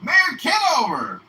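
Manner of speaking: loud, slow, high shout across the street; calling out. Retold or imitated. A constituent calls out to the mayor with a loud, slow shout. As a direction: hail him like a fan from a distance.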